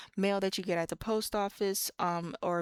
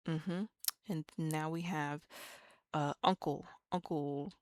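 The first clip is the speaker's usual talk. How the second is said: ordinary talk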